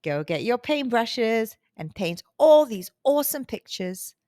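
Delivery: usual speaking voice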